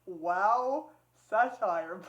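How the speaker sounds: WOW and SATIRE loud, set apart; loud WOW, pause, then SATIRE; quoted subtitle. Retold or imitated. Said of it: "subtitle it in quotation marks".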